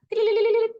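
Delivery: loud, high-pitched rapid trill; high trilling imitation. Shown as a demonstration. Teacher making a picture-book sound for listeners, high loud trill. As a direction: trill it out as a story sound effect.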